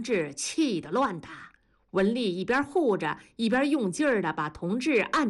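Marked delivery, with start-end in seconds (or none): none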